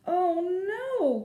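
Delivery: high, loud dismayed cry; sudden high cry of "oh no". A narrator's reading. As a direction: wail it in dismay. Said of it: "Goldie cried".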